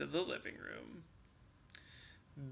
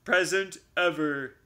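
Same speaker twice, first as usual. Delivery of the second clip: proud announcement; 7 dB louder than usual. Acted. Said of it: "Bear announced proudly".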